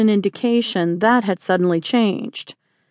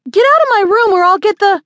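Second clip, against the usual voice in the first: high, loud, fast outburst cut short; outraged shout; 11 semitones higher, 6 dB louder, faster than usual. A narrator's reading. Young woman finding the marshal in her room, outraged shout. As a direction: yell at him to leave, breaking off abruptly.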